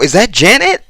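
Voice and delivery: high, rising disbelief; surprised double take. Retold or imitated. Viewer mistaking Reby for Janet, surprised double take. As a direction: pitch it up as a startled question.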